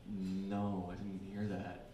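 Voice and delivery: hushed, held-back denial; quiet. Retold or imitated. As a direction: say it softly as if covering. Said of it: "I said".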